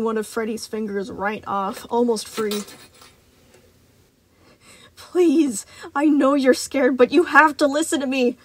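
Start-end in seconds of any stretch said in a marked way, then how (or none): 5.0-8.4 crying out plea. Acted. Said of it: "Freddy cried out".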